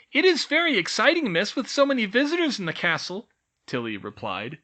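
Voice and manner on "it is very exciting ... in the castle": elf voice. A narrator's reading. Elf teacher excited.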